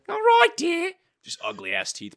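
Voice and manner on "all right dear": very high falsetto meek wife. Retold or imitated. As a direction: squeak out a meek little housewife. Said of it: "She's like".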